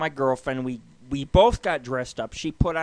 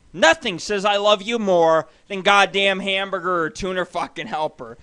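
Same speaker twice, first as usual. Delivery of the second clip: ordinary delivery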